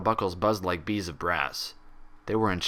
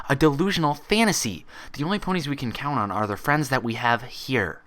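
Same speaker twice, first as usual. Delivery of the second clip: higher, louder heated lecture; angry rant; 7 semitones higher, 5 dB louder than usual. A narrator's reading. Twilight ranting at her reflection, higher and louder. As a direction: hammer the point home angrily.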